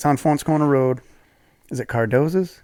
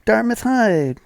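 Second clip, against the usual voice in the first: high, pleading "come on man"; insistent stranger; 11 semitones higher, slower than usual. Retold or imitated. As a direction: insist like an old classmate.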